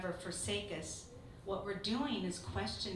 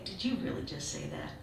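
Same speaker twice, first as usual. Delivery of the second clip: a gentle rebuke, only lightly voiced; God's voice; 6 semitones lower than usual. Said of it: "I felt like I heard God say".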